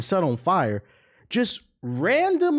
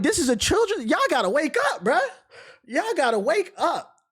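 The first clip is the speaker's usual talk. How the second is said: mock outcry; 10 semitones higher than usual. Acted outcry at the movie.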